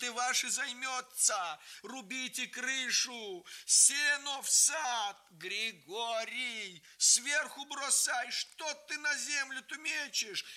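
rally the neighbours hard; urgent commands. A narrator's reading. Grandmother directing neighbours to fight the fire, commanding. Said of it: "говорила".

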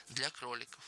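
soft, restrained repetition; subdued echo. A narrator's reading. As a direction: repeat it quietly, without enthusiasm. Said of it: "повторил Джордж".

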